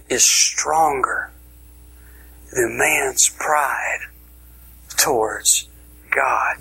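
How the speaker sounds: slow, hushed delivery; slow, near-whispered emphasis on man's pride. Real, not acted. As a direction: draw out each word to land the point. Preacher slows for key point.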